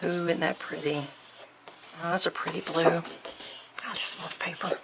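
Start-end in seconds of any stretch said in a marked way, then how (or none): 3.8-4.8 soft whisper, almost to herself; whispered love. Real, not acted. Crafter whispering her love of paper, softly. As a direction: breathe it out fondly. Paper haul, hushed delight.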